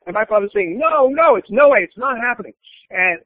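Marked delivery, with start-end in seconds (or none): 0.8-2.5 higher and louder flat refusal; playing dad shutting the deal down; mock stern father. Father refuses son's plea.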